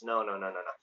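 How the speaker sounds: usual speaking voice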